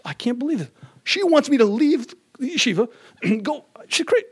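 high, rising indignant rant; exasperated outburst. Retold or imitated. Husband fuming after his wife calls him home from yeshiva, high and indignant. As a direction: let the disbelief boil over.

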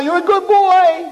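sweet high reassurance from above; defending angel. Acted. Angel Michal comforts Chaim in a very high voice. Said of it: "says Michal".

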